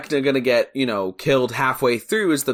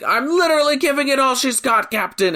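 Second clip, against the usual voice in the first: put-on raised, lightly done Scottish accent; a hint of a Scottish engineer; 10 semitones higher than usual. Engineer Scotty answering his captain, light Scottish accent. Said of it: "except in a Scottish accent".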